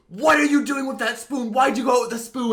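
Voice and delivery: high, loud imitation of a yelling mom. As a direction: shout it as an accusing, freaked-out mother. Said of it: "like, yelling at me".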